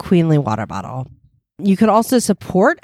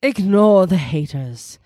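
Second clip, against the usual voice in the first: ordinary delivery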